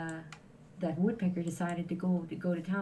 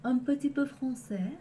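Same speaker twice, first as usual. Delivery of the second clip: French said in a showy, higher French accent; 6 semitones higher, 4 dB louder than usual. Shown as a demonstration. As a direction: put on a playful French accent. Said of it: "in a French accent".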